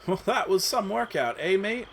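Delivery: casual British after the fight; British accent. Acted. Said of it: "says Kid".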